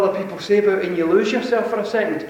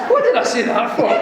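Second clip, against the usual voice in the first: laughing at his own gaffe; 5 semitones higher, 4 dB louder than usual. Real, not acted. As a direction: say it while cracking up.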